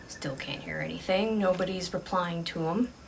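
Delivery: plain reading voice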